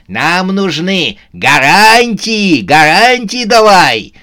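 high, loud, drawn-out demand; shouted demand. A narrator's reading. Сюзанна demands guarantees from the fugitive, shouting. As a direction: bellow for guarantees. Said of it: "крикнула Сюзанна".

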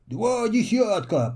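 a touch of storybook amazement; astonished. A narrator's reading. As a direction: Mossbeard amazed at the letters. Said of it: "поразился Моховая борода".

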